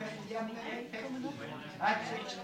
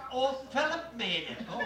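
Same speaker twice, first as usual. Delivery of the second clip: ordinary delivery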